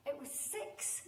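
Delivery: words dissolving into a loud laugh; laughing punchline. Real, not acted. Storyteller delivering the punchline, breaking into laughter.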